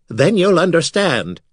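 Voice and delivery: high, urgent talking skull. Acted. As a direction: press it like a worried skull. Skull Mira speaks.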